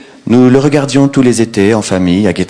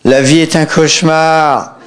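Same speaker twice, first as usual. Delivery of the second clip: voix aiguë, lente et déclamée; déclame comme un poète halluciné; poète exalté; 7 semitones higher, slower than usual. Retold or imitated. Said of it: "Le poète". (poète exalté qui déclame)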